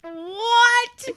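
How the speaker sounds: high-pitched disbelieving what; incredulous. Real, not acted. Reacts to absurd teaser.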